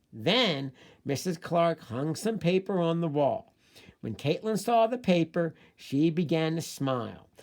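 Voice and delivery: put-on reading aloud to children, steady pace; slow classroom read-aloud; story reading. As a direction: read the story clearly for young readers.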